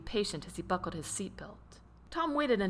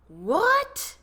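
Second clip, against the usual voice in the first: much higher, louder startled yell; 12 semitones higher, 6 dB louder than usual. A narrator's reading. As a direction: shout it in disbelief. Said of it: "yelled Tom again".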